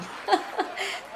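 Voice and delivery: unvoiced giggle. Real, not acted. Laughing at own story.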